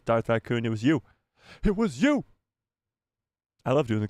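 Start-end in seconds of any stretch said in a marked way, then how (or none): 1.6-2.2 higher, swooping mock accusation; teasing, high-pitched reveal; playful accusation. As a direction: point the finger playfully, pitch jumping up.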